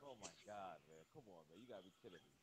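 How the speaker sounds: very faint, far-off phone voice; bad phone line. Real, not acted. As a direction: speak from far across the room. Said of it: "You sound still low".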